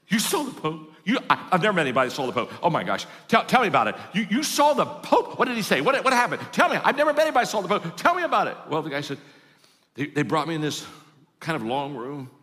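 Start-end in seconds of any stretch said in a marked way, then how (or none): 0.1-8.5 high, loud, gushing disbelief; astonished excitement. Acted. Barber hears his customer saw the Pope, wildly excited. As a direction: beg for the news, pitch leaping up, words tumbling out.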